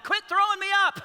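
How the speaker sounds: high, loud mock yell of a grown son; mock shout. Grown son yelling at his dad to stop tossing him, mock shout. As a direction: yell it at dad like a kid being tossed. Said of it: "I had to tell my dad".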